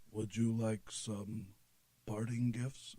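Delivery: slow, hushed imitation of a host; lightly voiced, unhurried offer, softly spoken. Voices [name] offering merchandise.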